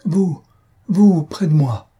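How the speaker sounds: high, loud, emotional exclamation; overcome with emotion. A narrator's reading. Patient reaching for the young woman, overcome with emotion. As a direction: draw it out high, overwhelmed to see her. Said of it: "d'une voix tremblante d'émotion".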